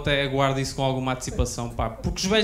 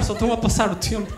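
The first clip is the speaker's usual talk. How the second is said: ordinary talk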